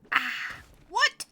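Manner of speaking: loud, high-pitched "what"; sudden startled shriek, voice leaping up; shocked outburst. Real, not acted. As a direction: yelp in disbelief. Shocked by game event.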